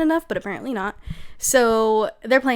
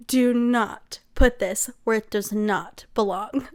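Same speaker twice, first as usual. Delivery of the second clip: slow, deliberate word by word; stern mock warning; slower than usual. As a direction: spell out the warning slowly and firmly. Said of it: "you're like".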